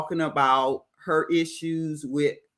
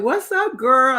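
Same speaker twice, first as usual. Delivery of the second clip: high, loud excited hello; girlfriend greeting; 7 semitones higher, 6 dB louder than usual. Retold or imitated. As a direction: squeal a greeting to your girl. Voicing Nadia greeting friend.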